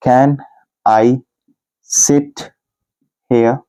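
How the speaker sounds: each word set apart with long pauses; slow, word by word. Shown as a demonstration. Teacher spelling out the question for students, word by word. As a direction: dictate it slowly, one word at a time. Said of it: "teacher dictates word by word".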